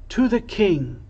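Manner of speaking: mild answering cheer; children's shout. A narrator's reading. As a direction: echo the cry as the children. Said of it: "The children shouted back".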